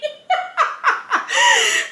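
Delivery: long, high-pitched fit of laughter; laughing. Real, not acted. Friends joking about the big man, high helpless laughter. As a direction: lose it completely. Cracks up at the joke.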